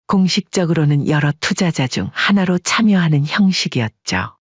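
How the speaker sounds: ordinary delivery, nothing marked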